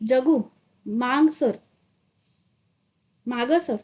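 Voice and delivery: firm, commanding warning. Acted. Damu firmly warns the enraged Jagannath to back off. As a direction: stand your ground and order him back. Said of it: "दामूचा कणखर आवाज".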